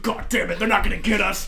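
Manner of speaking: loud acted defiance; worked-up mock cursing; hyped driver quote. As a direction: imitate a driver determined to escape. Quotes driver during the chase.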